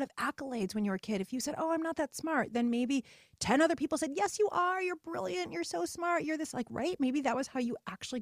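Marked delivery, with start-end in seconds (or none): 1.6-2.5 put-on higher, coy little-girl modesty; coy fishing for praise. 4.1-6.5 much higher, sing-song praise; shower her with praise; gushing reassurance. Retold or imitated. People praising the child.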